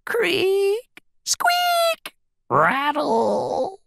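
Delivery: very high creaky sound words; bed noises. A narrator's reading. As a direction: imitate a squeaking, rattling bed. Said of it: "went Mouse's bed as he hopped in".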